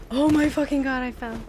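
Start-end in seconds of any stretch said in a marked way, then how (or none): none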